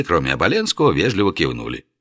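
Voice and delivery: plain reading voice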